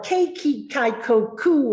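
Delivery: put-on punchy over-articulated "Baby, Bible, boo"; consonant drill. Shown as a demonstration. Teacher demonstrating a diction warm-up to learners, exaggerated articulation. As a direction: pop every b with the lips. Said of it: "maybe I say".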